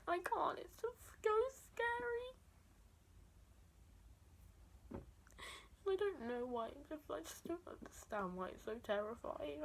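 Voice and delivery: real tearful breakdown, fading to a broken whisper; crying. Real, not acted. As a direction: voice cracking high into tears. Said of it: "I've been crying for".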